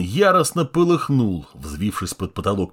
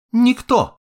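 curt woman; 13 semitones higher, 6 dB louder than usual. Acted. Woman answers defiantly.

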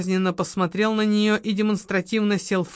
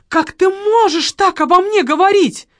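much higher and louder indignant wail; outraged cry; 13 semitones higher, 8 dB louder than usual. A narrator's reading. Offended widow wails at her lover, high and loud. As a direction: let the hurt burst out loud. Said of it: "вскрикнула Маргарита".

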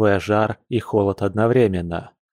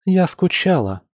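much higher, plaintive woman's voice; mildly tearful, pitched up; choked-up confession; 8 semitones higher than usual. A narrator's reading. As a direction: confess it with a lump in the throat. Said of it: "сглотнув ком в горле".

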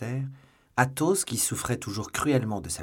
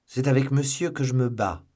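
louder, a touch higher noble duellist; Athos, firm; 5 semitones higher, 6 dB louder than usual. A narrator's reading. Athos presenting his opponent to Porthos, firm and proud. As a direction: state it calmly as a gentleman. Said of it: "dit Athos".

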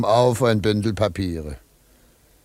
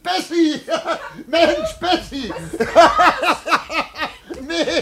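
words bubbling through laughter; giddy laughing shouts; laughing fit; slower than usual. Acted. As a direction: call her name while cracking up, high.

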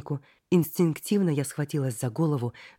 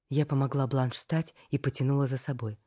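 usual speaking voice

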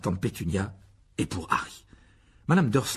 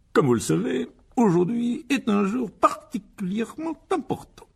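high, slow pompous announcement; grave, self-important; 13 semitones higher, 5 dB louder than usual. A narrator's reading. Uncle Vernon announcing an important day to his family, gravely pompous. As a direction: weigh each word with great ceremony. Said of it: "s'éclaircit la gorge d'un air grave".

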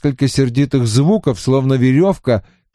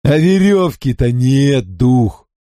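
sly teasing trickster spirit. A narrator's reading. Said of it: "захихикал Винкулюс".

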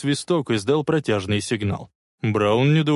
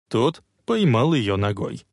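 usual speaking voice

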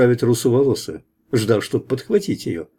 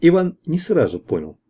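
ordinary delivery, nothing marked

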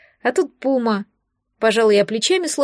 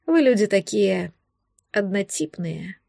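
drawn out; 3 semitones lower, slower than usual. A narrator's reading. Man judging humans as all alike, drawling. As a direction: draw the words out, easing down onto the last. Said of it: "Протянул он с ноткой".

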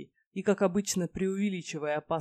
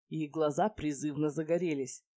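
usual speaking voice

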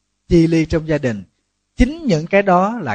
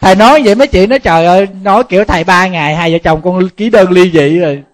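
much louder and higher, riding over the laughter; raised excited voice; 5 semitones higher, 10 dB louder than usual. Real, not acted. Monk jokes with the applauding audience, loud and excited. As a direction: push the voice up over the crowd.

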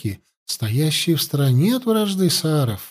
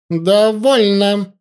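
slow, higher, loud bark; 7 semitones higher, 4 dB louder, much slower than usual. A narrator's reading. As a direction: cut them off with one furious word. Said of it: "рявкнула ситха".

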